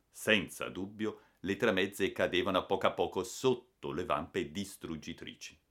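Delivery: ordinary delivery, nothing marked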